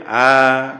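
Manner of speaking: "आ" को दो मात्रा तक लंबा, ज़ोर से; long vowel demo. Shown as a demonstration. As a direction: hold the आ long. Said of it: "दीर्घ हो जाएगा". (दीर्घ आ का उच्चारण)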